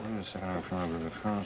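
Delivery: low, soft, indistinct mumble; mumbling. Acted. Reading the secret file to himself, mumbling. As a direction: mumble to yourself, voice dropped and soft. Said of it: "What are you mumbling about?".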